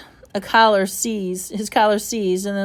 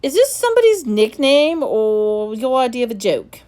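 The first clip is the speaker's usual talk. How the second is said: stern headmaster; 4 semitones higher, 6 dB louder than usual. Acted. Headmaster suspicious.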